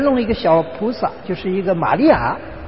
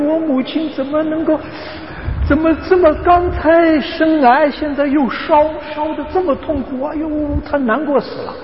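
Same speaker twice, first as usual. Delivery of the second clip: higher, anguished lament; distressed daughter; 8 semitones higher than usual. Retold or imitated. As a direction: cry out in grief for the mother. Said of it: "他难过死了".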